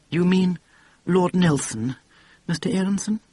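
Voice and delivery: put-on prim, ultra-refined English accent; polite, refined English accent correcting the boss; refined English accent, lightly done. A narrator's reading. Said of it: "ultra-refined accent".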